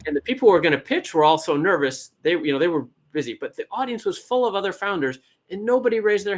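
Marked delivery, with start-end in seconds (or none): none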